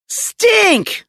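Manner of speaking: high-pitched yelp on bumping into someone; shrill surprise; startled outcry. A narrator's reading. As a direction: cry the name out high, caught off guard. Girl collides with her brother.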